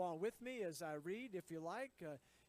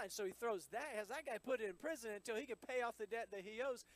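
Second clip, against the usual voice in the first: ordinary delivery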